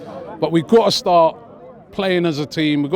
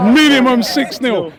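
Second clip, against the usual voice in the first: put-on a light Birmingham accent, slow and a bit louder; 5 semitones higher, 4 dB louder than usual. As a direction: drag out "Minimum" in a hammed-up Brummie accent. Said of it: "In a hardcore Birmingham accent".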